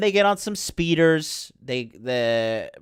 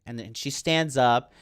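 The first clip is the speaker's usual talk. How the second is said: normal talking voice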